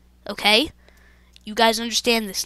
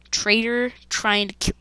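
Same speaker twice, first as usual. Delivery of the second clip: ordinary talk, nothing marked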